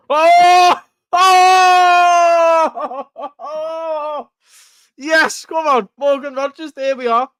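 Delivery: real roaring joy with a laugh; goal celebration. Real, not acted. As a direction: erupt as your team scores. Streamer's team scores goal.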